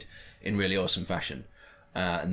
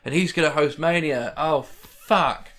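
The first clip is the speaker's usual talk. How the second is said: louder, higher mock panic; a touch of hammed-up alarm, louder; mock dread; 5 semitones higher, 5 dB louder than usual. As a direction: act out a fan's horror. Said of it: "You're just like".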